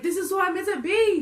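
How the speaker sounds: high, loud mock triumph; elated exclamation. Storyteller feels herself again after puking, elated high exclamation. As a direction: proclaim it with hammed-up joy. Retells elation after feeling better.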